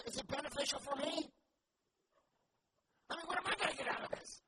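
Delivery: loud, high-pitched mock complaint; self-interested outburst. Preacher mimicking people who calculate what they'll get, loud self-interested outburst.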